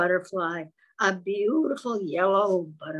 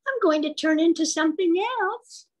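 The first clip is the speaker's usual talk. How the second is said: higher, louder, proud boast; proud little caterpillar; 9 semitones higher, 5 dB louder than usual. A narrator's reading. Caterpillar boasting proudly to the frog, high and loud. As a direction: puff up and announce it proudly. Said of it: "he said proudly".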